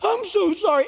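hammed-up fake contrition; mock apology. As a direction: parody a hollow, sing-song apology. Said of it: "you're always very sorry".

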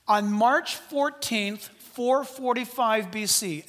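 slow, deliberate, spelling out "March fourteenth"; slow dictation. Shown as a demonstration. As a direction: say it slowly so they write it down. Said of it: "write it down".

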